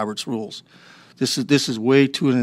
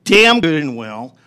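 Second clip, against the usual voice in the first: angry; 2 semitones higher, 7 dB louder, much slower than usual. Real, not acted. Heated council meeting outburst.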